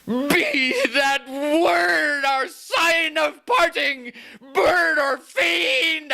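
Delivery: very high, slow crying shriek. Acted. Lover shrieking at the raven as he jumps up. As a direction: leap up and scream it. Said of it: "I shrieked upstarting".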